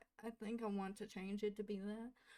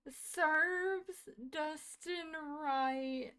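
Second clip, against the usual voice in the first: higher, very slow, stretched-out disbelief; saying it in slow, dazed wonder; stunned disbelief; 6 semitones higher, 6 dB louder, much slower than usual. Real, not acted. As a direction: drag each word out in shock. Repeating shocked reaction.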